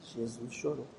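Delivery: low, very soft feeding of a line; quiet prompt. Shown as a demonstration. Prompting a child to say the verse into the phone, quiet prompt. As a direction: prompt a shy child under your breath.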